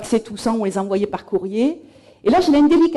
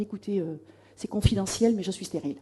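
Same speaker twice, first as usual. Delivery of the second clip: mock confidential; 2 semitones lower, 8 dB quieter than usual. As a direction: confide it softly, as if letting out a secret. Feigned confidence told to interviewers.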